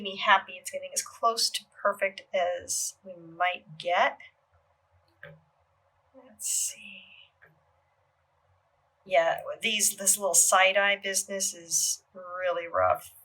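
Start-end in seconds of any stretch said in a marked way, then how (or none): 6.1-7.0 soft unvoiced whisper to herself. Real, not acted. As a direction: whisper it under your breath. Murmurs to herself while working.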